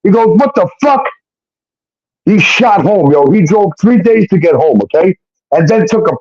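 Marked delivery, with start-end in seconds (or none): 0.4-1.0 high-pitched retold outrage; outraged disbelief. Bram reacting to her mail at his house, outraged. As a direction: imitate a man's stunned, indignant outburst.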